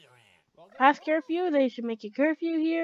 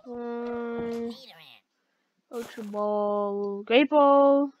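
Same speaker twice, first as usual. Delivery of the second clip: usual speaking voice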